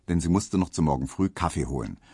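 usual speaking voice

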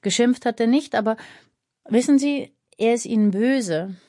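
lightly voiced child breaking news carefully; lightly voiced boy. A narrator's reading.

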